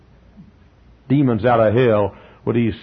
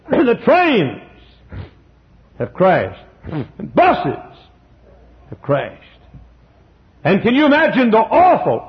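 rising, higher and louder sermon delivery; fiery preaching; 7 semitones higher, 3 dB louder than usual. Acted. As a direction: build the end-times picture with mounting fervour. Preacher builds apocalyptic picture.

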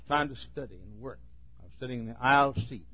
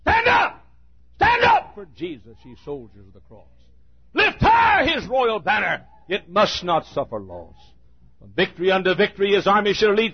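preaching at full force; 9 semitones higher, 13 dB louder than usual. Acted. Hymn shouted at full force.

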